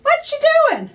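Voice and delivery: high, loud, laughing tease; teasing brother. Acted. Big brother teasing his sister, high and laughing. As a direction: needle her with a laugh.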